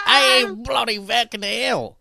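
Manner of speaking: high, slow, loud mock Aussie; hammed-up drunk Aussie cuss; drunken Aussie midget. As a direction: imitate the drunk little Australian. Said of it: "the drunken midget from down under".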